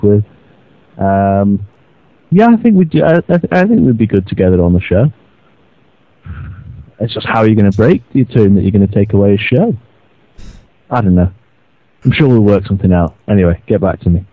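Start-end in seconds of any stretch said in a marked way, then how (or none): none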